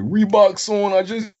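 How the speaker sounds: high, loud [name] impression; rapper imitation.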